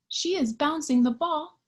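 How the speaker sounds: usual speaking voice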